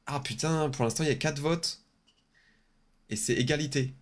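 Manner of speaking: talking through a blocked nose; nose stuffed up, words through it; stuffy nose. Real, not acted. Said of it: "je parle avec le nez bouché".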